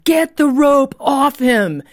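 high, loud, slow urgent order; shouted command. Retold or imitated. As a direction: reenact a forceful shout, each word drawn out. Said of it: "hear the Holy Spirit shouting".

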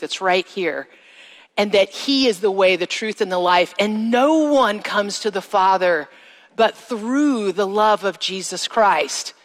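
usual speaking voice

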